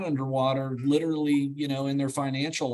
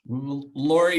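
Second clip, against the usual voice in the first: ordinary talk, nothing marked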